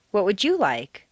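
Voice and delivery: strong accent on "you"; stress on YOU. Shown as a demonstration. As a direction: punch "you" to single that person out. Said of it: "This is to single out an individual".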